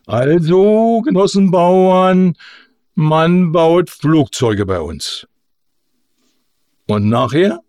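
folksy agitator making a speech; orator starting anew. A narrator's reading. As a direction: explain flying to the peasants in simple words.

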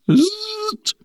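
thin, high "zzt"; quick whizz through the teeth; high whizzing take-off noise. A narrator's reading. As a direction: imitate a plane zipping upward. Orator imitates a take-off.

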